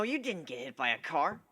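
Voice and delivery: ordinary delivery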